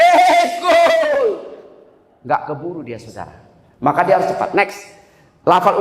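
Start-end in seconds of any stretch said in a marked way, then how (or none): 0.0-1.5 high, loud celebratory yell; explosive high-pitched cheer; commentator's goal shout. Acted. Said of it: "penyiar bola kaki".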